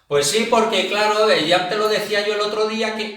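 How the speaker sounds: grito de español acalorado; gritando. Pretend. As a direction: grita a todo pulmón, como en una discusión. Imita a un español que grita. (hablando a gritos)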